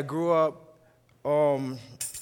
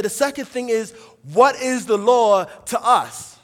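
loud, higher-pitched preaching voice; emphatic preaching; 6 semitones higher, 9 dB louder than usual. Acted. Preacher setting the stage on what the law is, emphatic preaching. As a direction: preach it out firmly, landing hard on key words.

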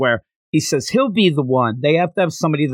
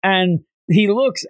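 ordinary talk

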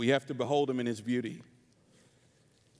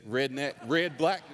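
ordinary delivery

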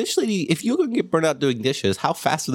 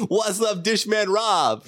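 mock cheery greeting; 9 semitones higher, much slower than usual. Suggesting a greeting to coworker.

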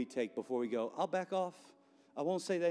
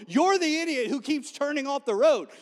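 much higher, shouted frustration; angry Balaam; 11 semitones higher, 9 dB louder than usual. Acted. Balaam shouting at his donkey, high and angry. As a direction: yell at the stubborn donkey.

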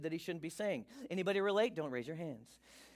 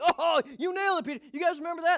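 very high, loud hammed-up gloating; crowing excitement; 10 semitones higher, 9 dB louder, faster than usual. Peter retelling his praise to the disciples, high excited crowing. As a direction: whoop it up, bragging to your friends. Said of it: "he was all like".

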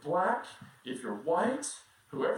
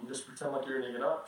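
usual speaking voice

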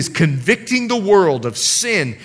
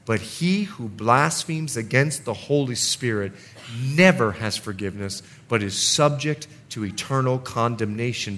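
solemn scripture reading; 7 semitones lower, 6 dB quieter than usual. Acted. Reads scripture softly.

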